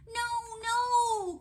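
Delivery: put-on high, loud childlike protest; long high wail of refusal; crying out. A narrator's reading. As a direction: cry out like a stubborn little frog. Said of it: "cried Froggy".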